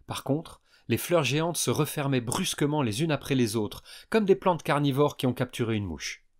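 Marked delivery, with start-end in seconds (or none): none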